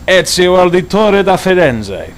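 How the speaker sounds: hammy Italian accent on the name. Shown as a demonstration. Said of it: "Sorry, I have a bad Italian accent".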